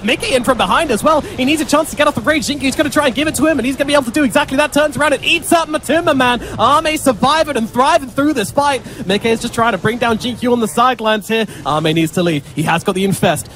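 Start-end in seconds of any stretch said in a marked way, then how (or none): 0.0-8.8 higher, quickening play-by-play; excited. Real, not acted. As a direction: build the fight call up. Fight turns mid-cast.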